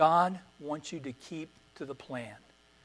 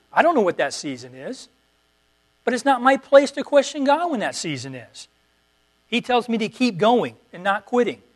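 louder, higher, driving sermon voice; fervent preaching; 4 semitones higher, 6 dB louder than usual. Acted. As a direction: preach it hard, pushing the congregation on. Preaching at full force.

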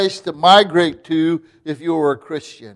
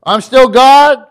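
higher and louder, full pulpit force; preaching voice of God; 5 semitones higher, 5 dB louder than usual. Acted. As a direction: speak as God reassuring the persecuted church. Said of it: "he's just saying to them".

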